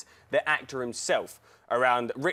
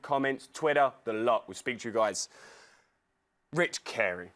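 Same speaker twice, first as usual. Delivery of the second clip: usual speaking voice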